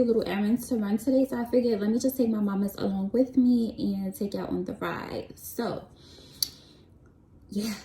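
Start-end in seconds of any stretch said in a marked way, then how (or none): 5.4-7.7 breathless, winded voice; out of breath. Real, not acted. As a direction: catch your breath between words. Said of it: "I'm, like, out of breath".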